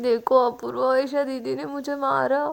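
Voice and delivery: put-on loud whiny childish wail; a tipsy girl tattling to her friend through loud childish crying. A narrator's reading. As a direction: bawl it out like a hurt little kid. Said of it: "रोने लगती है बच्चों की तरह".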